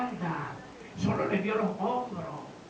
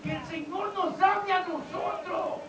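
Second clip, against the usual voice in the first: loud, high, impassioned sermon shout; full-force preaching; 9 semitones higher, 12 dB louder, slower than usual. Acted. Preacher urging congregation to treasure God's voice, impassioned shout. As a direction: build to a drawn-out, shouted climax.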